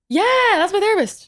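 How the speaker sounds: high-pitched, excited yes; excited exclamation. Real, not acted. Confirming to Paul that it's her therapist, excited. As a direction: burst out with delighted recognition.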